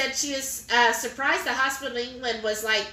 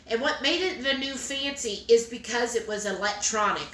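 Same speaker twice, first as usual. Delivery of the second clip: ordinary talk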